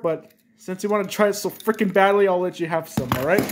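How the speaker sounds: ordinary delivery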